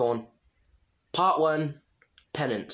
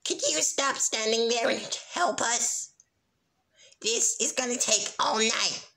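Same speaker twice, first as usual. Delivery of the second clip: higher, whiny complaint; Snips impression; 9 semitones higher than usual. Snips whining at Sunset to help, higher and whiny. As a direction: whine like a lazy henchman. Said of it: "Snips and Snails impressions".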